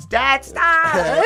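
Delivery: high yelled protest at dad; mock yell. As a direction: yell it high like a sore loser. Kid yelling at dad bowling.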